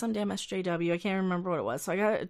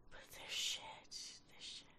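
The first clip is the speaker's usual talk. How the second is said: whispered aside; 19 dB quieter than usual. Real, not acted. Muttered under breath.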